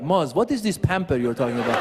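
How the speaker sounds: put-on puzzled man with a Persian accent. Comic voicing Persian men puzzled by pampering, Persian accent. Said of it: "some of the Persian men are like".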